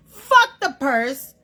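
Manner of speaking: slow, loud, punched rant on each fuck; emphatic rant. Real, not acted. Woman ranting to the camera, slow loud emphatic. Ranting about what women want.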